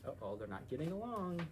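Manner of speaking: usual speaking voice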